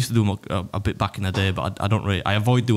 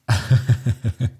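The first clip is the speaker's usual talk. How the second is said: short laugh. Real, not acted. Laughs at family joke.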